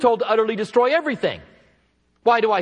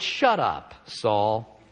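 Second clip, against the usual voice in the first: low, quiet "Shut up, Saul."; impolite shut up; 9 semitones lower, 3 dB quieter, much slower than usual. Retold or imitated. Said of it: "I don't think it was polite".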